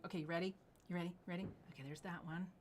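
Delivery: ordinary talk, nothing marked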